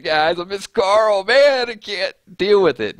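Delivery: high, slow hammed-up sobbing complaint; mock distraught crying. Vlogger mocking herself to chat, hammed-up distraught wail. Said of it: "No, it won't be like that".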